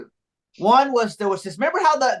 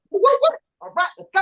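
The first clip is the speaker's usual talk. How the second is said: hyped-up guard; 12 semitones higher than usual. Retold or imitated. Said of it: "This one guy goes".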